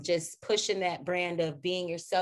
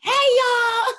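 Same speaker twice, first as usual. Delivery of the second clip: much higher, louder sing-song hello; bright, loud, high hammed-up hello; bubbly greeting; 11 dB louder than usual. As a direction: burst onto set with big energy. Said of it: "you're just so vibrant".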